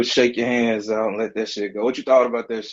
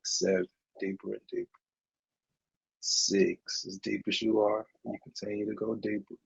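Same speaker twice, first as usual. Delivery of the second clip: anesthesia countdown; 3 dB quieter than usual. Acted. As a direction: count them down softly into sleep. Slow countdown into trance.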